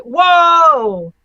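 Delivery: long, high, loud whoa; amazed whoa. Real, not acted. Startled reader breaks off mid-article, amazed. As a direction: burst out with a drawn-out whoa. Reacts to movie clip scream.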